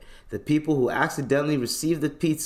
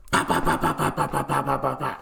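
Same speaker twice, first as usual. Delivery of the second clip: gunfire imitation.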